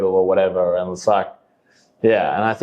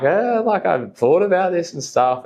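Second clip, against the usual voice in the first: pitched up high, a bit sheepish; sheepish pitch to dad; 9 semitones higher than usual. Retold or imitated. To his dad, pitching the golf job, high and sheepish. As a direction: play it as a nervous son selling the idea.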